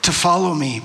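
deliberate reading with long pauses and sweeping pitch; scripture reading. Real, not acted. Preacher reading Genesis aloud to the congregation, measured and dramatic. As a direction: read it out measured, lifting key phrases.

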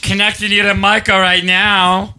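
high, slow operator sing-out; old-time switchboard operator. Pretend. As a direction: draw it out like a 1940s switchboard operator. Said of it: "the operators with all the wires".

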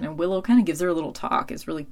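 ordinary delivery

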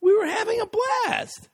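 high, laughing indignant yell; laughing outraged shout. Retold or imitated. Boyfriend yelling at girlfriend at the concert, high laughing outrage. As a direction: shout it out, half laughing at the absurdity. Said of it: "And I'm going".

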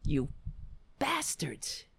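hissed whisper of contempt; whispered curse. Real, not acted. Ranting about the Capitol rioters, a whispered curse. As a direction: breathe the insult out unvoiced.

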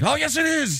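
high-pitched acted contradiction; hammed-up insistence, pitched high; mock indignant retort. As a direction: imitate an owner arguing back at a customer. Acts out husband arguing with customer.

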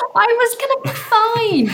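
high, laughing protest. Real, not acted. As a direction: laugh while insisting. Laughing defence after teasing.

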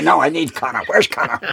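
high insistent mock demand; hammed-up fuming demand. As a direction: push the pitch up, impatient.

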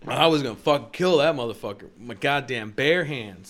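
loud, emphatic mock bragging; defiant inner boast. Secret answer to the cop in his head, defiant boast. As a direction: punch it out as a gleeful mock confession.

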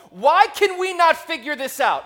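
fired up. Real, not acted. Venting to the audience about too many remotes, loud and exasperated. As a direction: vent at the pile of remotes. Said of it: "getting a little fired up there".

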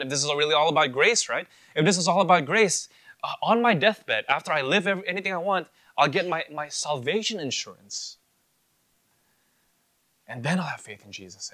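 preaching at full force, mocking the easy way out; mocking loud. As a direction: belt out the sinner's scheme. Preacher mocks deathbed conversion plan.

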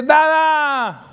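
put-on high, loud little-girl Dada; child's excited call. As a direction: call it like a toddler running to dad. Hija corre y lo llama. (grito alegre de niña pequeña)

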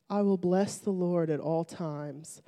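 soft, low, reverent verse reading; careful quiet reading. Retold or imitated. Reading the psalm again for a friend, soft and unhurried. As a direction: read it gently after a settling breath. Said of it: "read it slower".

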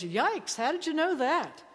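a touch of stunned wonder; amazed exclamation. Retold or imitated. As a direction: blurt it out in awe. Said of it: "he exclaims".

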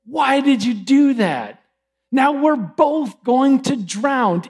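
dismayed dying frog. Acted. Stung frog protests to the scorpion.

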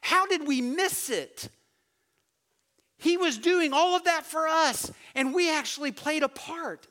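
regretful lament. Retold or imitated. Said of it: "looking back and going".

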